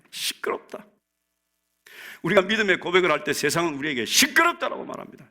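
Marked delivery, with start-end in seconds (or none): 0.1-0.8 whispered scolding, very soft; low-volume, voiceless repeat of the rebuke; hushed rebuke. Acted. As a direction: hiss it under the breath. Same rebuke repeated under the breath.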